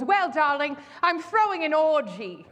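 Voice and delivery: slow, grand society lady saying darling; grand old lady. Retold or imitated. Old hostess inviting the storyteller to her orgy, grandly. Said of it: "'cause she literally talked like that".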